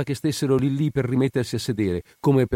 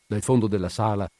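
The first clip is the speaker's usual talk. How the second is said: plain reading voice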